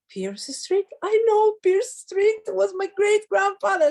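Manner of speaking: high, loud, amazed recognition; excited. Retold or imitated.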